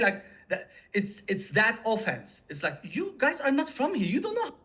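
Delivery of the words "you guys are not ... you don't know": indignant retort. Retold or imitated. Locals scolding newcomers who are not from here, indignant. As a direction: voice jumping much higher in protest.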